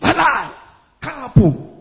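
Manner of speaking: sharp loud rebuke; voicing the spirit's defiant word; inner voice rebuking. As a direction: snap out a bold refusal. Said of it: "something just come and say".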